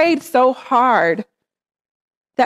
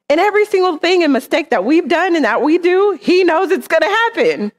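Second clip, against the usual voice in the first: much higher, pressing on every mistake; preaching fervor rising; building preaching; 7 semitones higher than usual. Acted. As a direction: drive the point home to the church.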